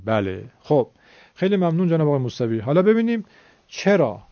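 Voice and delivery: usual speaking voice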